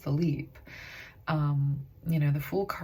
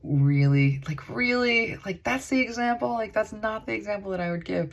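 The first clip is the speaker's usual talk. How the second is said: mock disbelief, pitched higher; incredulous; 6 semitones higher, 4 dB louder than usual. Reader mocks her own reaction to Spirit's odd example. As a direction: play up the disbelief, half laughing. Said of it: "I will be like".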